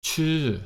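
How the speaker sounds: Chu pitched high then falling. Shown as a demonstration. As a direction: pop Chu up and bring it down. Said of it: "now it's falling tone".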